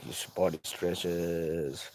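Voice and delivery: slow, soft, low and elongated; slowly pulled-out words, quietly; drawn-out stretching. Shown as a demonstration. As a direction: stretch the word "stretches" out as it trails away. Stretched word mimics stretching body.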